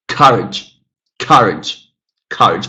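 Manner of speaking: "courage" with a strong, falling COUR; first-syllable stress. Shown as a demonstration. Teacher checking the pattern with students, first-syllable stress on "courage". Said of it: "Very clearly the first syllable is stressed".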